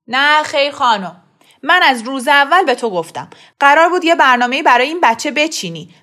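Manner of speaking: loud heated rant; angry father. A narrator's reading. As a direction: argue hard with the wife, ashamed. Father furious argument. (پدری عصبانی با فریاد در دعوا)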